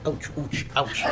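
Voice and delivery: soft under-the-breath ouch, ouch, ouch; mild hushed ouch on bare feet; muttered ouch to myself. Retold or imitated. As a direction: wince quietly, hiding the pain. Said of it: "So to myself, I'm like".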